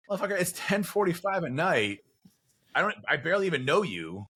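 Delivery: high, indignant exasperated rant; exasperated outburst. Retold or imitated. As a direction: let the late texter have it. Exasperated reply to late text.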